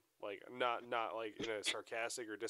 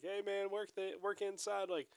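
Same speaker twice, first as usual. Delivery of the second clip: annoying rival coach; 11 semitones higher, 4 dB louder than usual. Retold or imitated. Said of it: "that is actually pretty fucking annoying".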